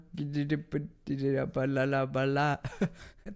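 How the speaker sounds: giggling, mangled babble of a name; laughing gibberish. Real, not acted. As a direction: laugh while garbling the name. Fumbling an unreadable name.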